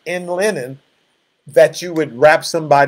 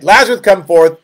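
louder, higher call into the tomb; 5 semitones higher, 8 dB louder than usual. Acted. Jesus calling Lazarus out of the tomb, a raised commanding call. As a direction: call him out of the grave, raised voice.

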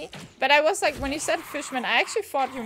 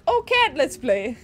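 talking to a pet; 4 semitones higher, 8 dB louder than usual. Real, not acted. Talking to her cat.

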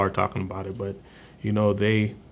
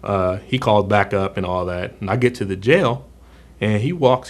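normal talking voice

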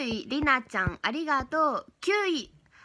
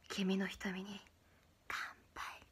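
much softer, voiceless whisper; whispered line; 5 semitones lower, 15 dB quieter, slower than usual. Shown as a demonstration. Streamer demoing the pickup line to chat, whispered. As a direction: breathe the cheesy line as a seductive whisper. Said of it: "こんなささやき声で言われたら".

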